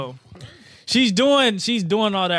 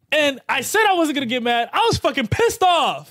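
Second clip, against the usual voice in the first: fired-up rant; 8 semitones higher, 4 dB louder than usual. Real, not acted. Recalling his fury at her story.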